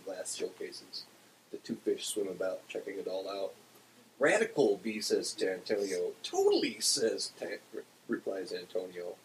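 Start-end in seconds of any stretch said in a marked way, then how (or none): 4.2-4.8 louder, higher cheer; excited exclamation. A narrator's reading. Fish admiring the head shop, excited cry. 6.2-6.8 very high, loud squeal; high-pitched agreement. A narrator's reading. Fish agreeing with his friend, high squeal. Second fish replies in story.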